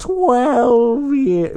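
lightly voiced old person; a hint of an old man; elderly voice. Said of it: "talk in, like, elderly voice".

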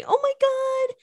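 high, loud squeal at the photos; delighted squeal. Real, not acted. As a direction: squeal over something adorable. Seeing photos of the kids.

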